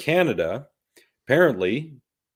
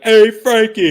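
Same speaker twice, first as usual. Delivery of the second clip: loud, much higher wiseguy hello; hammed-up New York accent, much louder; New York greeting; 12 semitones higher, 12 dB louder, slower than usual. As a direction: belt it like a Jersey greeting. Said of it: "and be like".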